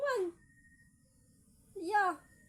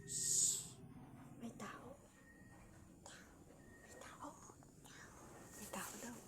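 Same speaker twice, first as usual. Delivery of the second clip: hushed whisper, someone's outside; whispering while hiding; 6 semitones lower, 16 dB quieter than usual. Real, not acted. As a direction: whisper so no one hears. Hushing baby, someone outside. (pabulong na nagtatago)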